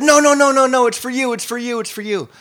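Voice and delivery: put-on high, loud childish wailing protest; crying child. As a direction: wail like a four-year-old. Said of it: "I started crying and said".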